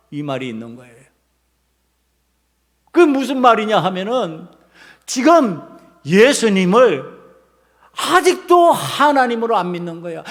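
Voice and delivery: usual speaking voice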